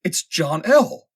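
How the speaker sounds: high, loud and drawn out slowly; excited exclamation. A narrator's reading. Inventor recognizing his cat, exclaiming with excitement. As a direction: blurt out the cat's name in sudden delight. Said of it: "he exclaimed".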